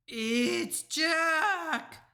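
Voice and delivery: high, loud, stretched cry; terrified bellow. A narrator's reading. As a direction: bellow it in nightmare terror. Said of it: "bellowed the flesh lump eater".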